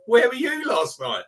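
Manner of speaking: high-pitched quoted taunt; mock challenge. Quoting a taunt at a mate.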